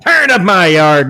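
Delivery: put-on loud grumpy old-man echo; echoing the cohost with a loud mock grumble; mock outrage. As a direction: bellow it like a cranky old guy.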